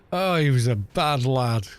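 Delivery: usual speaking voice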